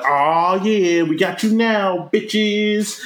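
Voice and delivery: mock bully gloat. Teen bullies cornering the kids, slow smug gloat. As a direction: drag it out like a cocky teen. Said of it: "they come up and they're like".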